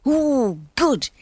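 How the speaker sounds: a touch of delight at a small comfort; pleased thought. A narrator's reading. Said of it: "he thought".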